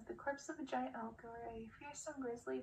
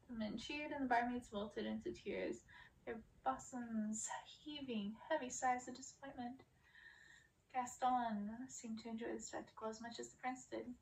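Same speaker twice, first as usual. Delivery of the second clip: ordinary delivery, nothing marked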